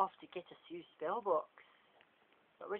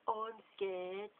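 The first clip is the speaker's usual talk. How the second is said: slow sarcastic voice; sarcastic mock fear; 4 semitones higher, much slower than usual. James mocking Draco's threat, sarcastic. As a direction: pretend to be scared, mocking.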